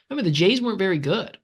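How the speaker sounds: ordinary talk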